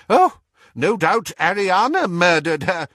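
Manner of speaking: high, loud would-be sarcasm; attempted scorn. A narrator's reading. Doge retorting to Muriel, attempting scorn. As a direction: scoff bravely, not quite convincingly. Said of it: "with a brave attempt at scorn".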